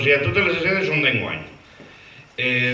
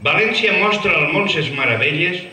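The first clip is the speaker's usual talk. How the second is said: verse recital; 3 semitones higher than usual. Acted. Presenter reading a falla's opening verses aloud, declaimed recital. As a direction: declaim verse in long, sweeping lines.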